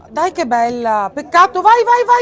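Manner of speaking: rising excited urging; excited cheering. Real, not acted. Cheering an attack.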